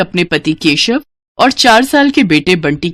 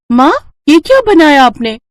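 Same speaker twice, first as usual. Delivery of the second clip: put-on high, louder-edged child's question; curious little daughter; 8 semitones higher, 4 dB louder than usual. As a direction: ask mother like an eager child. Daughter curious about new dish. (उत्सुक बच्ची की आवाज़)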